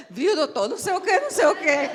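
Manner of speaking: high, laughing apology to the boss; laughing, flustered. Retold or imitated. Mother apologizing to the director while her son shouts, laughing. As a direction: stammer an excuse through laughter. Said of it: "e aí ela".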